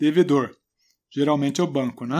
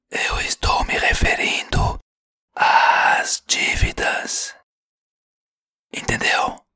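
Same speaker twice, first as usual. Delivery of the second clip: slow whisper into the ear; breathy secret whisper, drawn out; right in your ear; slower than usual. Acted. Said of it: "Eu vou falar bem próximo ao seu ouvido".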